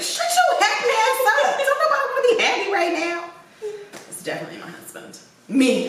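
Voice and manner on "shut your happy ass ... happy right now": high mock snap. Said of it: "and it's like".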